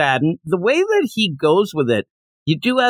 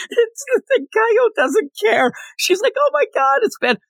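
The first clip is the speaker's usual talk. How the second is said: mock crying. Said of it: "She's crying".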